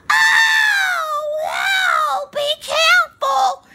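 high, loud, shrieking warning; hammed-up high shriek, slow on careful; mock horror warning. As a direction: scream a warning at the screen.

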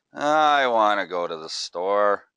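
slow, drawn-out and firm, leaning on "I"; slow deliberate tone. Shown as a demonstration. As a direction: say it slowly and deliberately, stressing "I". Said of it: "I can change that exact phrase just with my tone".

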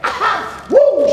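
imitating a girl cheering, high and bright; high excited whoops of a little girl; girl's cheer. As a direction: shriek with joy like a winning ten-year-old. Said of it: "She's going".

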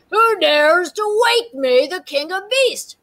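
slow booming lion roar. A narrator's reading. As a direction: boom it out like an angry king lion. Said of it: "roared the lion".

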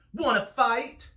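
high, loud dare; pugnacious bug challenge; grouchy ladybug. A narrator's reading. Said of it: "said the grouchy ladybug".

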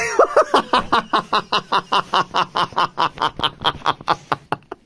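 long breathless giggle, high; long high laugh. Real, not acted. Laughing at his own mix-up with his brother. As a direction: burst out laughing at the story.

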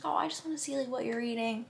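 lightly voiced dad, high and soft; dad's gentle voice. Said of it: "and he was just like".

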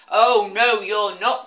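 loud troll refusal. Acted. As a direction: bellow like a troll. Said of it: "said the Troll".

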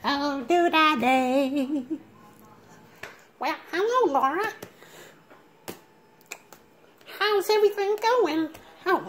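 nerdy high Urkel whine, fussing at Myra; a light take on the squeaky sitcom nerd; Steve Urkel. Retold or imitated. Vlogger imitating Steve Urkel scolding Myra, nasal nerd whine. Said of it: "I'm finna do a voice impression of Steve Urkel".

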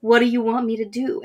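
normal talking voice

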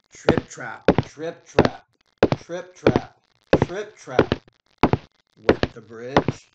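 ordinary delivery, nothing marked

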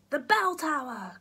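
loud, high urgent cry; cried out. A narrator's reading. Grandpa cries out to Jack where to run, loud and high. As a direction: call it out urgently. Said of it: "cried Grandpa".

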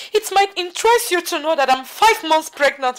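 high, loud retort; defiant announcement. Acted. Wife telling mother-in-law she's pregnant, defiant shout. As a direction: declare it forcefully in your defence.

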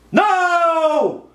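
high, long-held scream of no; anguished shout. Real, not acted. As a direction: yell it out in disbelief. Loses the game again.

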